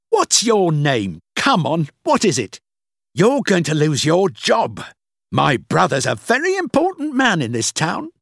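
high, loud, threatening bluster; bully the clerk at the top of your voice; angry shouting. A narrator's reading. Guest threatening the clerk's job, angry shouting. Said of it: "He was shouting at the man".

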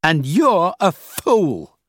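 high, loud, slow insult; contemptuous. A narrator's reading. Boss insulting Rachel as she quits, contemptuous. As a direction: draw it out with scorn.

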